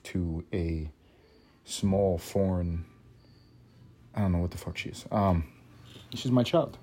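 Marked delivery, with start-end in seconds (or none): none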